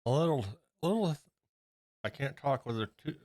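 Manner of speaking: talking around a paintbrush in the mouth. Real, not acted. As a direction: mouth full, paintbrush between the lips. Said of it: "I got a paintbrush in my mouth".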